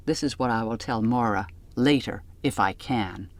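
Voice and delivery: plain reading voice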